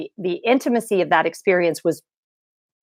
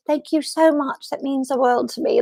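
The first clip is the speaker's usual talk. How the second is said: imitated British accent; 6 semitones higher than usual. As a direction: imitate her British accent, pitched high. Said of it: "in her British".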